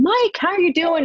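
high-pitched, upbeat acted hello; hearty greeting. De Niro greeting her dad on set, hearty greeting.

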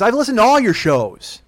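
loud, high mom imitation; mock accusing mother. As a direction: play an indignant mother over the phone. Mother accusing son, acted.

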